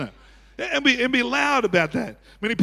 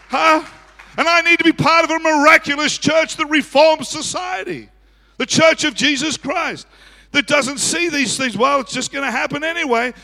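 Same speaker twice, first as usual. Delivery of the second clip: loud, high preacher, gradually easing; full-force preaching; 7 semitones higher, 5 dB louder than usual. Real, not acted. Preacher challenging a passive church, exhorting. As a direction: exhort them with a raised, driving voice.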